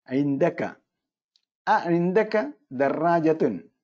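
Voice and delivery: each word dictated slowly with അ weighted; slow it down for the students; careful classroom model; slow model sentence. Shown as a demonstration. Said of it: "ഇൻദക എന്ന വാക്ക് ഉപയോഗിക്കുന്നു".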